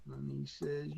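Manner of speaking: normal talking voice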